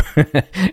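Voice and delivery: high burst of amused laughter; a high, delighted laugh; real laughter. Real, not acted. Recalling friend's puzzled reaction.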